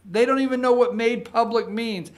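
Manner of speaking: ordinary delivery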